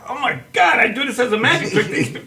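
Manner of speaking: high, laughing disbelief; shocked realization. Retold or imitated. Recalling the news report, high laughing shock. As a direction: pitch up in laughing alarm. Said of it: "I was like".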